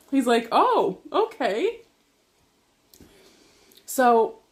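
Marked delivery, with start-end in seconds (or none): 0.5-1.8 slow, high, swooping mock surprise; taken-aback guard. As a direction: imitate a startled guard, drawing it out. Imitating the startled security guard.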